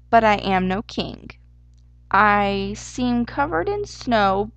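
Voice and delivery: loud, slow, deliberate recitation; declaimed riddle. A narrator's reading. Easter hare gives the group a hint, declaiming slowly. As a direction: proclaim it grandly, stretching phrase endings. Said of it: "Then he declared".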